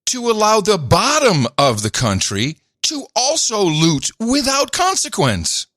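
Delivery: high, strained voice pushed to the limit; straining voice. Real, not acted. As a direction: push the voice high until it strains. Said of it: "You're straining your voice".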